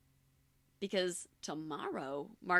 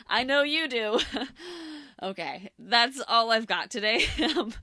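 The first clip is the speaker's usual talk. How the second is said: laughing; 4 semitones higher, 10 dB louder than usual. Real, not acted. Laughing sign-off.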